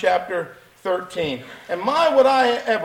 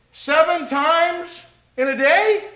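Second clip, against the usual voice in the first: Peter's eager question; 9 semitones higher, 7 dB louder than usual. Acted. Peter voiced asking Jesus.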